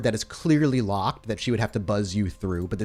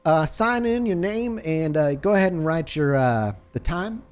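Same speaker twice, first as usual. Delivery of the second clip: nurse Susie voice; 7 semitones higher than usual. Acted. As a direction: draw it out up high like the nurse.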